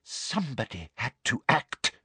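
hushed self-justification; breathed menace. A narrator's reading. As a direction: breathe it out softly. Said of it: "breathed Umbridge".

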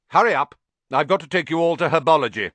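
loud, high, fast bark; barked order. A narrator's reading. Snape barking over the class's heads. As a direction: hurry the class out. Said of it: "barked Snape".

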